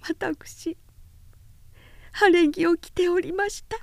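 put-on high, sobbing young woman. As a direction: choke the words through sobs. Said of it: "くくとむせび上げながら".

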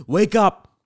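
loud, high wake-up call; rise-and-shine yell; wake-up shout. Acted. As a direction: holler them out of bed. Morning wake-up call to listeners.

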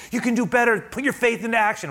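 high, loud pep talk; urgent self-exhortation. Acted. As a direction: push yourself hard to do better. Acted urging of oneself.

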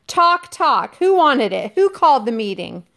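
high, loud, furious shout; angry shouting. Acted. Ralph shouts at Jack in front of the boys, high and angry. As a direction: yell it at the whole assembly. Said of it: "shouted Ralph".

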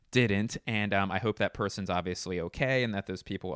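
ordinary delivery

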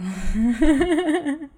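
high, loud helpless laugh; burst of real laughter. Real, not acted. Vlogger laughing at how strange it was, helpless laughter. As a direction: laugh out loud, unable to stop.

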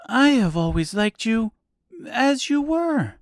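ordinary delivery

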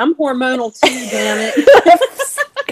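tearful laugh at being emotional; laughing through tears. Real, not acted. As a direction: burst out laughing at yourself.

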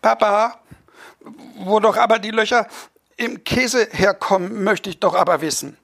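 put-on high, pleading child's voice that yelps at the shove; a small boy begging to know; whiny little boy. Boy nags his parents at the dinner table, high and whiny. Boy asks father again.

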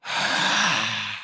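exaggerated audible breath, let out long; demonstrative big breath. Shown as a demonstration. Speaker halting a meeting to breathe, exaggerated breath. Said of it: "I need to breathe".

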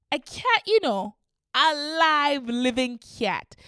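high, loud horrified disbelief; outraged disbelief. Acted. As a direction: squeal it in outrage. Horrified at finding a cat.

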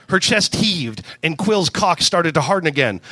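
ordinary delivery, nothing marked

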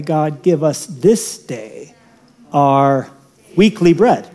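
slow, drawn-out prayer; mock slow prayer. Preacher misquoting the Lord's Prayer, slow prayer voice. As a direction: pray it slowly, then the wrong word. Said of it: "we're told to pray".

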